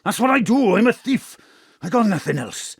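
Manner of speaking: loud high angry bark; angry shout. Acted. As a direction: yell down the wife. Father snaps at wife.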